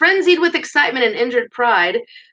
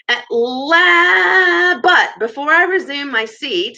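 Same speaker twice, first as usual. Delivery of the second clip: lightly raised speechmaker's voice; louder, higher start to a speech; speechmaker over applause; 5 semitones higher, 4 dB louder, much slower than usual. A narrator's reading. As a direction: raise your voice to address a noisy banquet.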